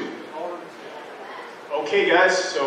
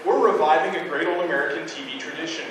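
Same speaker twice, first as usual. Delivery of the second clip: ordinary talk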